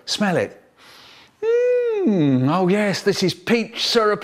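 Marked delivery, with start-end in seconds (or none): 1.4-2.5 long high mmm of delight; tasting something delicious; savoring mmm. Acted. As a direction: hum with pleasure at the taste. Tasting the peach syrup.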